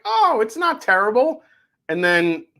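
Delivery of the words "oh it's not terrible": retold first reaction. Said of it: "I'm like".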